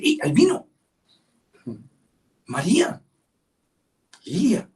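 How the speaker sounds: high, excited call to someone lost in thought; God calling out. Pretend. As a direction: call out high to snap them back.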